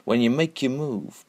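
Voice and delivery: ordinary talk, nothing marked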